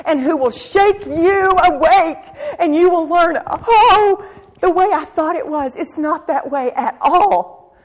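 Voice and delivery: crying out with feeling; tearful preaching. Real, not acted. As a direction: preach through tears. Preaching, voice breaking.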